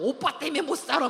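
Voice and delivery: loud, high mock complaint; hammed-up fed-up outburst; exasperated wife. Imitated newlywed wife fed up with her husband, loud exasperation.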